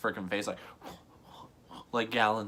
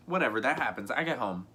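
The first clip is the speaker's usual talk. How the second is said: ordinary delivery, nothing marked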